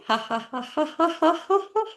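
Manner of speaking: high, rapid staccato laugh; fake laughter. Shown as a demonstration. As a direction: force out rising ha-has while tapping. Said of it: "as you're making laughing sounds".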